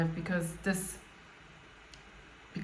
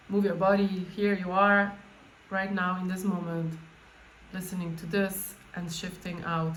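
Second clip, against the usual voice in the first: guided meditation; 2 semitones lower, 4 dB quieter than usual. Acted. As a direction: gently guide them into the body.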